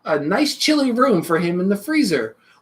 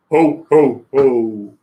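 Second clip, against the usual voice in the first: deep, hearty Santa ho ho ho; jolly Santa laugh; 7 semitones lower, 4 dB louder, much slower than usual. Acted. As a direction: boom it out like Santa. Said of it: "who that was that came down that chimney".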